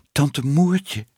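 plain reading voice

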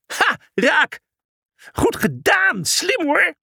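hoge, uitgelaten juichkreet; juichen. A narrator's reading. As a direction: juich heel hoog om de voltreffer. Personages juichen om de worp. (uitgelaten gejuich)

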